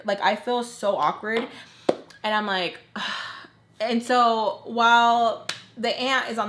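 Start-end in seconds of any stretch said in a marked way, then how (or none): 2.8-3.4 short breathy huff; exasperated sigh. Retold or imitated. Storyteller feeling so awkward about payment, exasperated sigh.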